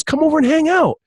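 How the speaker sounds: high-pitched, friendly urging; eager retold invitation. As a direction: imitate a buddy's casual invite. Retells Kevin Hart's invitation.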